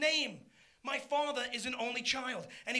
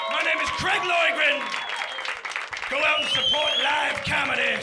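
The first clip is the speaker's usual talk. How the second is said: shouted sign-off; 3 semitones higher, 9 dB louder than usual. Real, not acted. Comedian closing the set to the crowd, shouted sign-off. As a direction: belt it out over the applause. Signing off over applause.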